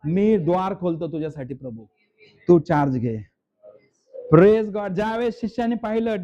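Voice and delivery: ordinary talk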